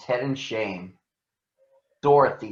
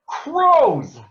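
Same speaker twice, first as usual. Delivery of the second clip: much higher, loud cry of alarm; alarmed shout; 13 semitones higher, 7 dB louder than usual. Acted. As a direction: yell a warning at the sky. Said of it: "shouted the Scarecrow".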